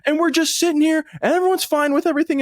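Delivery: hammed-up upset teenager complaining; whiny younger self. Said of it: "I'm like".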